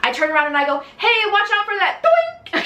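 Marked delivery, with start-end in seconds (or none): 1.0-2.0 high-pitched, re-enacted heads-up; called-out warning. Rider warning the friend behind her on scooters, acted call-out. Reenacts calling a warning back. 2.0-2.6 high-pitched cartoon "doink"; comic impact noise. Acting out a friend flying off a scooter, comic sound effect.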